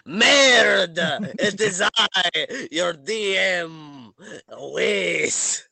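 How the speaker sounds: put-on high, slow, grand French accent. Game master introduces himself to the players, grand French accent. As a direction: announce yourself with a hammy French flourish. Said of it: "I will do the French accent".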